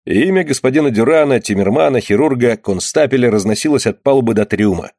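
ordinary delivery, nothing marked

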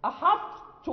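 أحط with a firm emphatic ط closure before a doubled ت; أحط stressed, the ط's heaviness kept into ت; incomplete assimilation. Shown as a demonstration. Teacher repeats أحط for students, ط heaviness kept. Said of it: "يعني ننطق بالإطباق".

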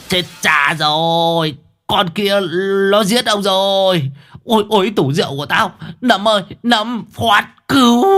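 high, frantic wailing; old Đồng wailing in panic as the goat wrecks his house; panicked old man. A narrator's reading. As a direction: cry out in dismay, pitched high.

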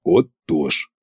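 put-on low, creaking old sorcerer; creaky Koschei. A narrator's reading. Koschei approving the payment from the wall, creaky. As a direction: creak it out low, satisfied to get his due. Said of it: "проскрипел Кощей".

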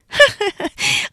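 high, mocking female laugh; helpless laughter. A narrator's reading. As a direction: laugh uproariously at them. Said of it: "Hứa Yến cười rũ ra".

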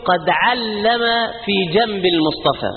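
ordinary talk, nothing marked

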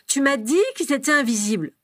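"dit" punched high and loud; protest, hitting "dit" hard and high; stress on DIT. A narrator's reading. Albus holding parent to promise, "dit" stressed high. Child insists on what was told.

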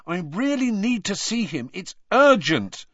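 high, insistent plea; angry urgency. A narrator's reading. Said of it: "he said angrily".

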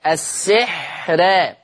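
السحر slow with the ra kept thin; thin light ra. Shown as a demonstration. Teacher repeats the model, slow thin ra. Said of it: "صوت الحرف وهو خارج".

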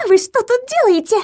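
high shrill squeal of shock; shrieking. A narrator's reading. Nurse caught with Viktor, shrieking at Lilya. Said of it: "визжала она".

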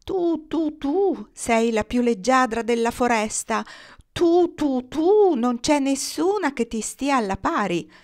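high, drawn-out "tu, tu" courtship coo; cooing dove. A narrator's reading. Male dove courts his mate, cooing.

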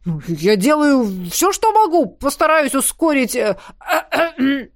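panicked voice breaking into coughs; choking cough. Acted. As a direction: sputter and choke as if strangled. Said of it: "зашёлся в сиплом кашле".

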